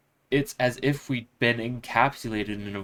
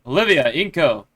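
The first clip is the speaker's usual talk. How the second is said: usual speaking voice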